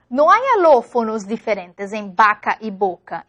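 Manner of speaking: usual speaking voice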